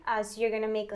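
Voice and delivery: usual speaking voice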